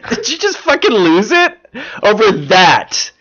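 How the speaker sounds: high-pitched amused disbelief; incredulous. Real, not acted. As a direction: call out someone for cracking up over nothing. Mock outrage at cohost's laughing fit.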